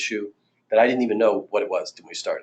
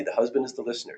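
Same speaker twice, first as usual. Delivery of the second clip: ordinary delivery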